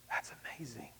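hushed, breathless whisper of wonder; whispered amazement; awed whisper. Real, not acted. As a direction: breathe it in awe. Whispered then spoken repeat.

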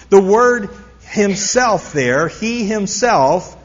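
slow down and press "himself"; stress on himself. Shown as a demonstration. Preacher to congregation, stressing "himself" slowly. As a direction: lean into "himself", slow and firm. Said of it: "is emphatic".

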